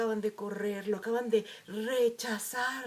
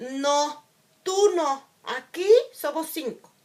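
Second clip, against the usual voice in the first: louder and higher, a cutting no; harsh rejection; 4 semitones higher, 10 dB louder, slower than usual. Retold or imitated. The wounded child hears classmates' refusal as harsh, loud rejection. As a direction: hit it like a door slammed in your face. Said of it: "tu herida te hará leer la información como".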